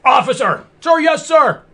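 high barked military reply; drill sergeant. Acted. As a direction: snap to attention and bark it. Military roleplay shout.

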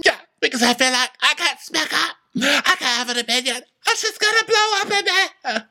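high, wailing mock crying; [name]. Answering that she can't speak up, high fake sobbing. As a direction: squeal it through fake tears. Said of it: "Are you crying?".

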